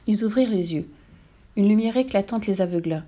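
plain reading voice

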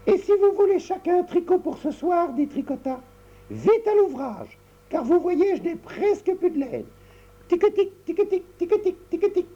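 put-on bossy little girl. Tricotta orders her brothers to work.

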